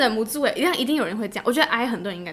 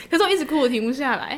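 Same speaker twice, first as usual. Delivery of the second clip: ordinary delivery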